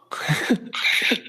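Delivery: short airless laugh at himself; breathy laugh. Real, not acted. Storyteller laughing at his own dismay, a quick breathy laugh. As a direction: laugh off the awkward moment.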